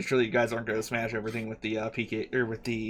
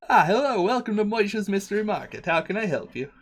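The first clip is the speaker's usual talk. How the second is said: shopkeeper voice; 7 semitones higher, 4 dB louder than usual. Acted.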